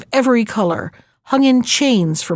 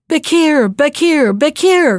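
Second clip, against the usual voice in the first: loud, higher calls of the name, each one falling; calling out; 6 semitones higher, 4 dB louder, slower than usual. Acted. Old woman on the bridge calling the servant Bekir, loud calls.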